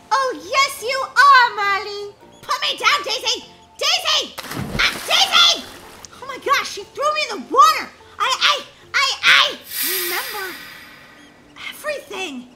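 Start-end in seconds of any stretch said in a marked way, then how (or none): 2.4-5.6 high, loud panicked yelling; shrieking protest. Acted. Girl shouts at sister carrying her to water, protesting. 6.2-9.6 high, loud shocked stammer; shocked outcry. Acted. Girl just thrown in the water by sister, shocked outcry.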